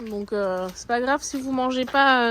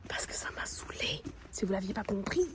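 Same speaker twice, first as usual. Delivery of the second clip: hushed, voiceless aside to the viewer; very soft whispered grumble; 5 semitones lower, 12 dB quieter than usual. Real, not acted. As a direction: whisper it as a pointed afterthought. Whispered aside, comic repeat of complaint.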